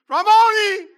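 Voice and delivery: a full exclaiming shout of "Rabboni!"; exclaimed. Shown as a demonstration. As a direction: call "Rabboni!" out in joyful recognition. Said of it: "with an exclamation point".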